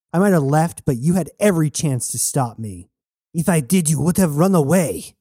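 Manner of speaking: high-pitched, accusing comeback; heated retort. Acted. Jazz and Knox quarrelling over leaving, heated retort.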